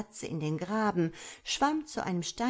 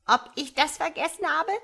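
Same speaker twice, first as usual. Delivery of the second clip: high, loud indignant question; incensed; 10 semitones higher, 7 dB louder than usual. A narrator's reading. Frog mother retorts to her tadpole, incensed. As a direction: throw the question back in outrage. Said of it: "erwiderte der Frosch erbost".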